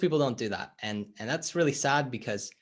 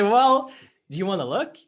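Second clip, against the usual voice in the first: louder, higher gentle challenge; acted coach nudging; 6 semitones higher, 9 dB louder than usual. Coach inviting the reluctant client to look inside, louder and higher.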